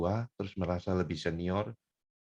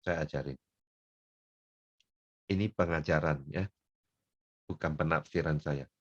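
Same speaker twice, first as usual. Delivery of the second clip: normal talking voice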